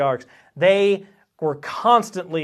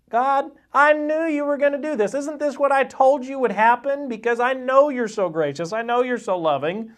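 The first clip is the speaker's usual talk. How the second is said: much higher, complaining prayer voice; whiny Jonah; 7 semitones higher than usual. Retold or imitated. Jonah complaining to God in prayer, high whiny voice. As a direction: whine at God like a sulking child.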